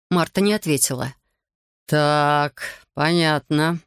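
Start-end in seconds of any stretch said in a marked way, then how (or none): none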